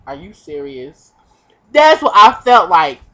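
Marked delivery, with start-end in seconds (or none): 0.1-1.1 unimpressed deadpan. Retold or imitated. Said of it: "superhuman bitch is like".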